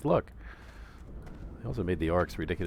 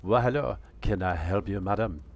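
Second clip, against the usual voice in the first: a hint of an oily salesman, louder; shoe salesman; 2 semitones higher, 5 dB louder than usual. [name] welcoming a difficult customer, smarmy and loud. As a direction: greet her like a fussy clerk. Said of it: "I'm doing a Steve Martin bit".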